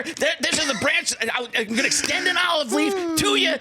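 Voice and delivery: high, shouted, breathless coach voice; Steve Mariucci impression. Imitating Mariucci to the host, high shouting. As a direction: yell it up high, racing along. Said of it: "Steve Mariucci, I'm gonna keep on doing it".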